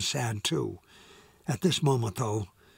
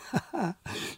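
short laugh; 6 semitones higher, 7 dB quieter than usual. A narrator's reading. Laughs at herself.

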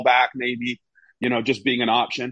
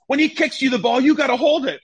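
high, raised hammed-up telling-off; mock manager scolding; 14 semitones higher, 4 dB louder than usual. Manager correcting goalkeeper, hammed-up scolding. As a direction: lecture him as the angry boss. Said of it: "He goes".

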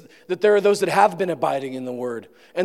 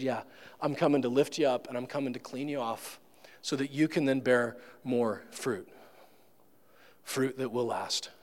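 gentle reassurance; 4 semitones lower, 8 dB quieter than usual. Pretend. God voiced as gentle gardener.

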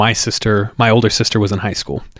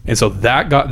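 plain reading voice

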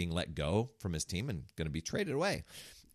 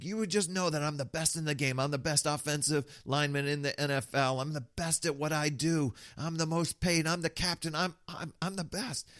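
a hint of swaggering bragging, pitched higher; boastful; 7 semitones higher than usual. Coach plays the star lineman, lightly voiced boasting. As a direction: own the room like the star. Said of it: "he stepped into that".

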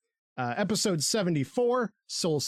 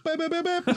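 high, far-off beeping noise; imitated sound effect; 13 semitones higher, faster than usual. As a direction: chirp it like a cartoon sting. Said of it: "laugh track fully".

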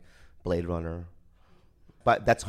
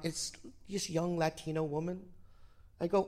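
ordinary talk, nothing marked

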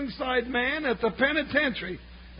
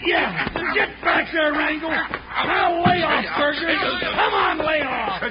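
loud scuffle shouts; shouting; 3 semitones higher, 4 dB louder than usual. Acted. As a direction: yell while pulling them apart. Pulling fighters apart.

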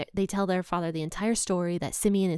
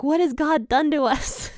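high, loud imitation of panic; hammed-up panicked outcry; mock panic; 7 semitones higher, 5 dB louder than usual. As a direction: wail it in pretend panic, about to laugh. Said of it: "they are just panicking".

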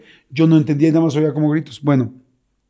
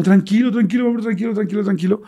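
high, repetitive calming murmur; imitated soothing; 6 semitones higher than usual. Partner stroking his hair after the scare, high soothing voice. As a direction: act out gently talking someone down.

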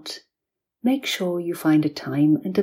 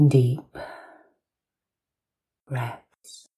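guided hypnosis; slower than usual. Acted. Hypnosis session after long pause.

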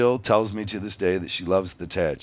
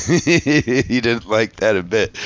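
real gloating laugh, louder and drawn out; gleeful laughing; 4 semitones higher, 8 dB louder, much slower than usual. Real, not acted. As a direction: laugh through it, slow and loud, relishing it. Gloating over the judge's annoyance.

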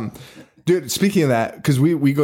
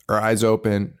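normal talking voice

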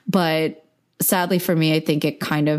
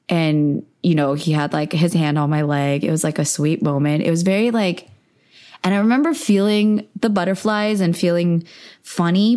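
ordinary talk, nothing marked

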